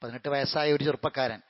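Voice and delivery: the preacher resumes gently after the pause, calm and low; calm second khutbah. Acted. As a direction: settle into a quiet, measured closing sermon.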